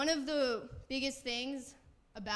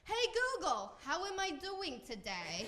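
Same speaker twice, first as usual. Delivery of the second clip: New Jersey mom impression; 8 semitones higher than usual. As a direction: mimic a Jersey mother asking her gadget, stretched out. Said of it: "she has the very strong accent".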